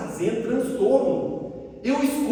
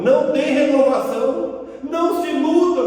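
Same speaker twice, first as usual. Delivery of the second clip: raised, louder preaching voice; emphatic warning; 5 semitones higher, 6 dB louder than usual. Real, not acted. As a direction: drive the warning home, higher and louder. Warns the audience emphatically. (advertência enfática, em voz alta)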